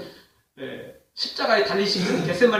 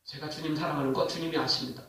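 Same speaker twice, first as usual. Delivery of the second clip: soft, low answer from a humbled disciple; subdued reply; 5 semitones lower, 9 dB quieter than usual. Retold or imitated. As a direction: imitate a humbled reply, voice dropped. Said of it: "베드로 또 똑같이 대답해요". (낮고 조심스럽게 흉내 낸 대답)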